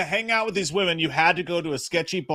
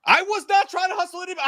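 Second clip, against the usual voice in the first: much higher, fast defensive protest; indignant denial; 12 semitones higher, faster than usual. Real, not acted. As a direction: deny it, offended.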